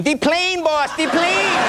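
high, urgent cry of the plane; panicked aide yelling. Retold or imitated. Aide warning the boss as the plane comes, high panicked cry. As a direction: shout the warning like a frantic sidekick. Stephanopoulos imitated running with warning.

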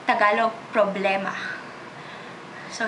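ordinary delivery

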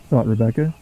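ordinary delivery

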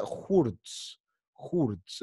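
"hurts" read with a Spanish U, like "hoorts"; Spanish-style vowel. Shown as a demonstration. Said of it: "En español es U".

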